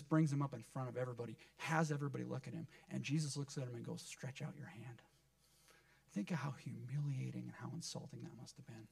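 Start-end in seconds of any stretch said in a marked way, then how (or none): 4.0-5.0 slow, whispered instruction; hushed command. Retold or imitated. Jesus to the man before a watching room, slow whisper.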